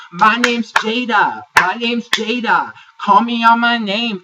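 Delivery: high, loud, slow hammed-up protest; mock indignant Jada. Recapper mocking Jada's loud protest over her name. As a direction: drag it out, loud and offended. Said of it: "And Jada's like".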